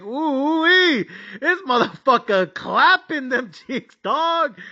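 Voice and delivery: high, stretched-out "ooh wee" of delighted disbelief; stretched, gleeful sports-fan hoot; hyped disbelief. Retold or imitated. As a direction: cheer it like watching a blowout. Hyped reaction to lopsided score.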